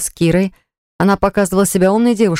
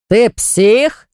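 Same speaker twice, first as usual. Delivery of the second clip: loud, high angry roar; shouting in a fight; 5 semitones higher, 3 dB louder than usual. A narrator's reading. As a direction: bellow it at him in fury. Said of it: "взревел Баграт".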